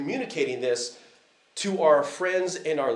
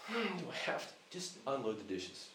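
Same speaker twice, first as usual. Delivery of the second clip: put-on a quick, softer, sulky kid's grumble; whiny reluctant daughter; 14 dB quieter, faster than usual. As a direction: lightly mimic a child dragging her feet over chores. Daughter's reluctant reply voiced by dad.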